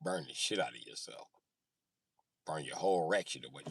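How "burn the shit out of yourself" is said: ordinary talk, nothing marked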